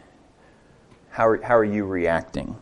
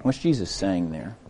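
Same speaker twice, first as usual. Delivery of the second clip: normal talking voice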